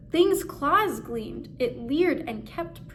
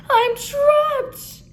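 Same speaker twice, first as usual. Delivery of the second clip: much higher and louder, panicked shriek; terrified screech; 13 semitones higher, 9 dB louder than usual. Acted. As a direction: shriek it, cornered in the cave. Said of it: "he screeched in terror".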